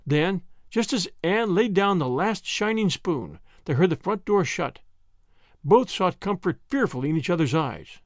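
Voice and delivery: usual speaking voice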